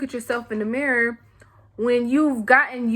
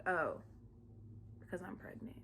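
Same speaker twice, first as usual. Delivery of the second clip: very quiet, halting mock apology; acted chastened near-whisper; sheepish realization; 5 semitones lower, 24 dB quieter than usual. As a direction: mumble it small, with long pauses. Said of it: "then you have to think".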